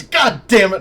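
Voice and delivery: loud, high furious curse; angry outburst. Acted. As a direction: yell it at the locked door. Sky fails and punches wall.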